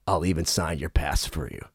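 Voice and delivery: very low, quiet offer; deep, soft imitation. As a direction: drop right down, imitating the star. Imitates [name] offering autograph.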